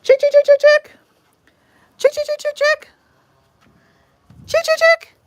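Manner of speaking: high, loud sing-song chicken call. Real, not acted. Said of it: "Let's call the chickens over".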